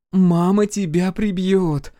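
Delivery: high, slow, drawn-out warning; lazy warning. A narrator's reading. Ранит warns runaway brother, lazily. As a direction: lazily stretch out the threat. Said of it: "констатировала Ранит лениво".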